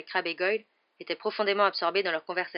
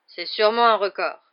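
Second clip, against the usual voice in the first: put-on slurred drunk; 3 semitones higher, 10 dB louder than usual. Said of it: "dit-il d'une voix pâteuse".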